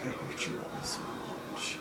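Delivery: whispered, mock-bitter; whispered mock grudge at the crew. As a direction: breathe it out in a fed-up whisper. Fed-up retelling of redoing scene.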